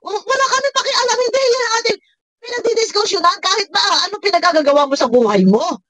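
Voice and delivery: high-pitched, insistent rebuttal; indignant dismissal. Real, not acted. Reactor telling Manay it is not the topic, indignant. Arguing back at woman's played clip.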